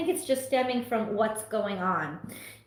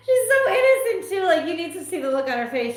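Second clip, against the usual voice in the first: higher and louder through giggles; laughing while talking; 8 semitones higher, 5 dB louder, faster than usual. Real, not acted. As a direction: gush about the pet while still laughing. Laughing about her pet.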